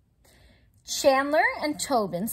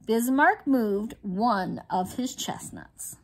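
plain reading voice

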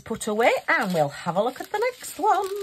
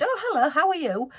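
friendly greeting; 8 semitones higher, 3 dB louder than usual. Retold or imitated. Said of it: "somebody's just said".

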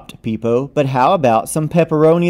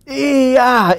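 spicy gasp; 11 semitones higher, 3 dB louder than usual. Acted. First bite of spicy food.

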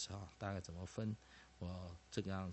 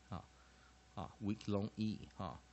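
ordinary talk, nothing marked

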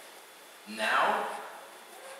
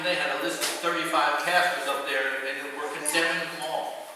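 usual speaking voice

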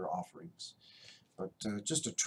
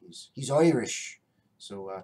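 normal talking voice

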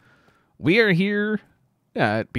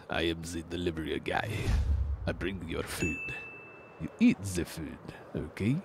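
delivery guy voice; 9 semitones lower, 9 dB quieter than usual. Acted.

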